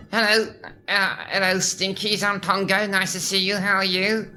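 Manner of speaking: high, bright cheeky puppet voice; cheeky skunk puppet greeting kids; Pongo voice. Shown as a demonstration. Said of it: "Pongo is".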